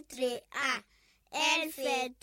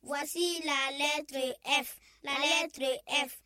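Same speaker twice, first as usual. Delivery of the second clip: ordinary delivery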